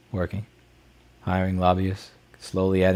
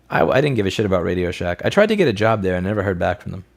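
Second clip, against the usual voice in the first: usual speaking voice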